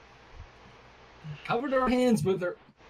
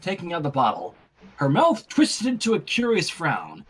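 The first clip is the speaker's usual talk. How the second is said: ordinary delivery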